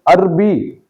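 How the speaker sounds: عربی in two syllables, "ar-bi"; غلط تلفظ. Shown as a demonstration. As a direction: say عربی as "arbi", no vowel after the r. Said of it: "زبر پڑھنے کے بجائے جزم". (عربی، رے پر جزم کے ساتھ)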